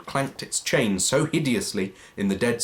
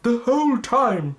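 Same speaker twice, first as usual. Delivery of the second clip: ghostly wail; 14 semitones higher, 5 dB louder than usual. Acted. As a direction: intone it like a phantom. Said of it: "said the ghost".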